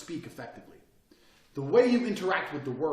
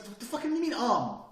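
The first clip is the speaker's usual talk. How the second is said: much higher, exasperated disbelief; incredulous mock reply; incredulous retort; 8 semitones higher than usual. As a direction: scoff at the rambler. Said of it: "it's like".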